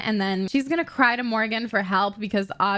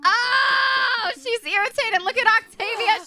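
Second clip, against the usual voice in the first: excited shout; 12 semitones higher than usual. Real, not acted. Excited reaction to the fight.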